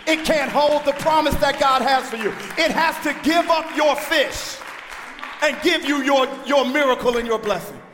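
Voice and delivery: loud, driving sermon delivery; full-force preaching. Acted. Preacher urging the congregation past their drama, loud preaching.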